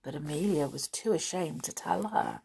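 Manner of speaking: ordinary delivery, nothing marked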